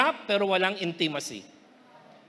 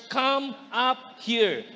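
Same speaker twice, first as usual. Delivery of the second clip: slow proclamation; much slower than usual. Acted. Word of the Lord.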